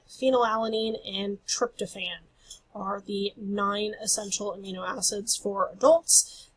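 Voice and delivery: usual speaking voice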